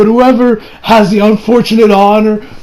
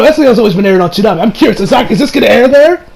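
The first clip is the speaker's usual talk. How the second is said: normal talking voice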